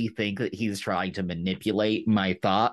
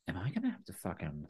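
low threat; 6 semitones lower, 12 dB quieter, faster than usual. Retold or imitated. Daemon threatening Criston, retold.

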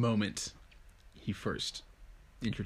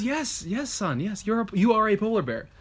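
higher, emphatic mother bear; mother polar bear; 8 semitones higher than usual. Acted. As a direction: insist to the cub, pitched up a little. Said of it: "And the mother goes".

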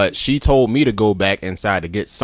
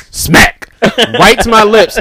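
excited; 10 semitones higher, 5 dB louder, much slower than usual. Retold or imitated. Retelling a slap, acted out.

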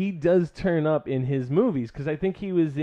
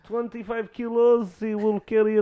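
higher-pitched comic Middle Eastern accent; 6 semitones higher than usual. Shown as a demonstration. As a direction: put on an eager Middle Eastern haggler's accent. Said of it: "as far as my Middle Eastern goes".

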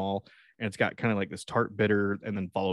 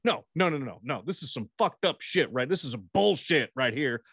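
mock tree rage; 7 semitones higher than usual. Imagined dad raging at tree.

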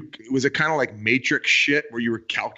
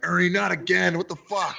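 higher mock exasperated co-pilot voice; 8 semitones higher than usual. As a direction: play the fed-up co-pilot who has seen this before. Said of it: "the co-pilot was like".

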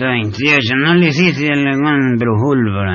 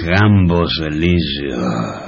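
put-on drunken dreamy reverie; 6 semitones lower, 4 dB quieter than usual. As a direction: sigh it out, lost in memories.

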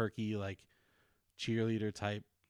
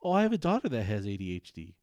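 mock entitled lady, louder and put-on; snooty teacher; 7 dB louder than usual. Mocking the teacher's excuse to the dad, snooty voice. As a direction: sneer out her excuse.

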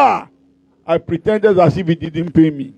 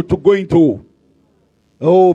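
ordinary delivery

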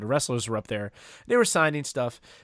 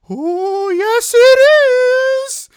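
high, slow, drawn-out eerie wail, louder; Paul Bearer's spooky voice; 17 dB louder, much slower than usual.

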